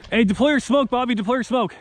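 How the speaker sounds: high, pressing command; urgent order. Real, not acted. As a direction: bark the order to teammates.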